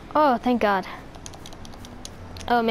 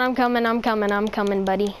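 normal talking voice

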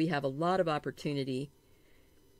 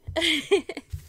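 laughing; 11 semitones higher, 5 dB louder than usual. Real, not acted. Laugh after the sneeze.

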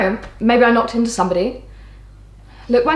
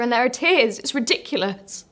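usual speaking voice

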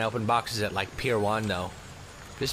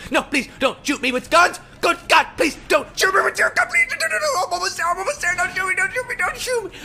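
very high-pitched panicked squeal; mock frantic pleading; 5 dB louder than usual. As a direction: beg for your life in a hammed-up falsetto. Mock pleading with guards not to shoot.

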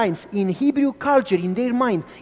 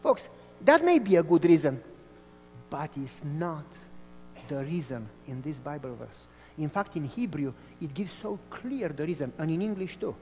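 soft sermon; 4 semitones lower, 11 dB quieter than usual. Acted. Soft preaching over music.

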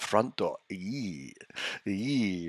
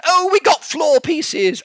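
much higher, louder, slow gasp of surprise; shocked exclaim; 10 dB louder than usual. Real, not acted. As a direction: burst out in shocked disbelief. Shocked reaction to item.

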